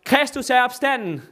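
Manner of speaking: high, loud Easter proclamation; priests calling out. Retold or imitated. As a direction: call it out like a priest at the church door. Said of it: "præsterne løber ud og råber".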